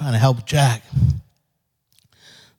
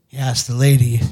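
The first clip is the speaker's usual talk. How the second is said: ordinary delivery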